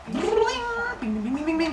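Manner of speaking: very high sung bing strumming; playful lyre noise; plucking noise. Retold or imitated. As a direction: pluck the lyre with your voice, delighted. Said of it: "and Apollo's like".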